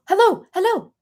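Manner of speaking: high, loud mock hellos; eager strangers calling out. Runner imitating strangers trying to get her attention, eager high hellos. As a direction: call out insistently in a high put-on voice. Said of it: "they were like".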